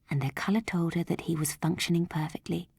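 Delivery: ordinary delivery, nothing marked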